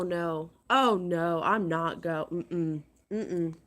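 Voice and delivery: ordinary talk, nothing marked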